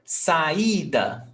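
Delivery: "Saída" with the middle vowel held; penultimate stress. Shown as a demonstration. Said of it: "before last".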